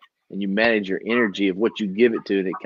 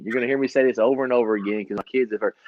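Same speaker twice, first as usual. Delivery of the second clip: normal talking voice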